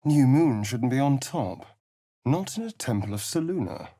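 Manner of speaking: ordinary talk, nothing marked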